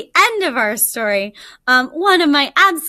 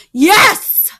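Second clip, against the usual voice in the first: a loud, higher, roared yes; tiger roar; 6 semitones higher, 7 dB louder than usual. Acted. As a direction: roar it back at the jackal. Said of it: "roared the tiger".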